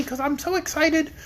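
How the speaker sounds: high whiny lion "excited"; Cowardly Lion voice. Pretend. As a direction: quaver "excited" like a scared lion. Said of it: "Cowardly Lion voice".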